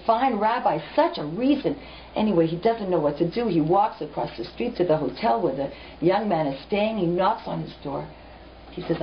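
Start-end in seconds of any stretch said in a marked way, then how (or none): none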